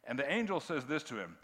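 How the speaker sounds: ordinary talk, nothing marked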